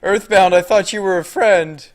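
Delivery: high, loud playful outcry; mock betrayal. Streamer accuses chatter Earthbound, mock betrayal. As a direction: protest like a wronged friend, hammed up.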